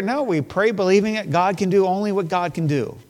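ordinary delivery

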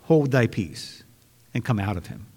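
low, quiet, firm command; Jesus calmly ordering the spirit out; rebuke. Acted. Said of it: "Jesus rebuked him".

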